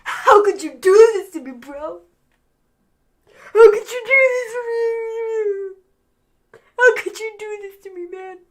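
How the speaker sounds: high, hammed-up wailing sob; mock crying. Host acting out Jay pleading with Roman, fake tearful wail. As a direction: bawl it like a badly faked breakdown. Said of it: "crying some more".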